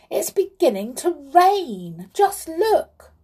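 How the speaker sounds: high-pitched alarmed exclamation; urgent, startled announcement; sudden cry. A narrator's reading. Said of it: "cried the clown suddenly".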